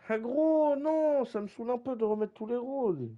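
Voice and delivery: high, loud exasperated whine; annoyed outburst. Real, not acted. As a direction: complain loudly, fed up. Annoyed complaint.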